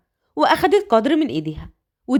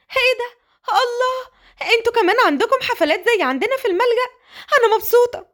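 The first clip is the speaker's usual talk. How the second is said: put-on much higher, childlike delight; 10 semitones higher than usual. Orphan Qadr thrilled there are parties like the orphanage, childlike delight. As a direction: squeal with joy. Naive girl delighted about party.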